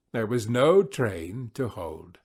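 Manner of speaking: ordinary delivery, nothing marked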